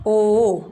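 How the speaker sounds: ओ held long and loud; drawn-out o. Shown as a demonstration. Teacher stressing the long ओ for students, drawn out loud. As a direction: stretch ओ out and say it loud. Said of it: "ओ को हम थोड़ा खींचते हैं".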